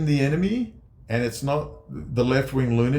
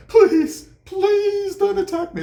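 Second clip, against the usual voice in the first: much higher, loud whining plea; mock begging; 4 dB louder, slower than usual. Mocking Maduro begging America, high whine. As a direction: ham up a groveling plea for mercy. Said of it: "he's begging America".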